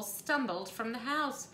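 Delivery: plain reading voice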